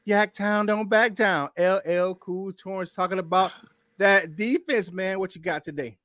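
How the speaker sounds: rhythmic, punched-up intro patter; hype-man introduction. Acted. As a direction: bring on the co-host like a ring announcer.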